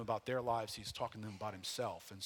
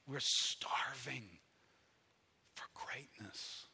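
slow, near-whispered plea; hushed emphasis; slower than usual. Real, not acted. Preacher drops voice for emphasis.

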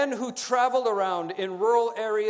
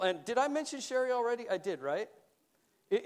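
normal talking voice

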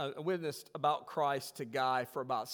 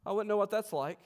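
retold sarcastic reply, pitched up; 8 semitones higher, 7 dB louder than usual. Preacher replies to his complaining friend Guy, sarcastic. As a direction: feign innocence with raised pitch, dripping irony. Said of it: "he heard the sarcasm in my voice".

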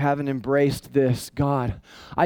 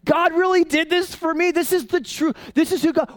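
much higher, astonished outburst; amazed excitement; 12 semitones higher than usual. Retold or imitated. Pastor acting his own discovery of grace, high amazed exclamation. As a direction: gasp it like the news just hit you. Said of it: "It's like".

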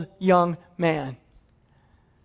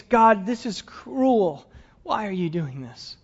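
slow and softer, pained; anguished prayer; 3 dB quieter, slower than usual. Acted. Abraham crying out to God in his head, slow and pained. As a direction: drag it out in despair.

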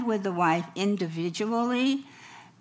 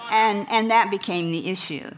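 normal talking voice

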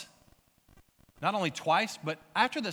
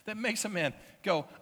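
usual speaking voice

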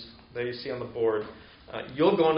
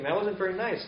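higher-pitched mild reproach; child's reproach; 7 semitones higher than usual. Retold or imitated. Little boy telling off his parent, mild reproach. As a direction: lightly imitate a little boy, pitch rising. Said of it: "he's, you know, kinda thinking".

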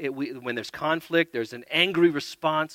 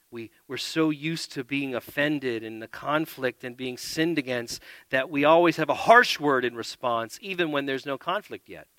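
ordinary talk

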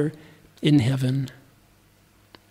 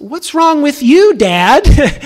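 put-on child talking back; 7 semitones higher, 5 dB louder, much slower than usual. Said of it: "He said".

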